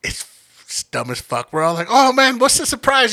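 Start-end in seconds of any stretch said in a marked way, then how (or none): 1.9-3.1 high, eager question; mock excitement. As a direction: play up the excitement.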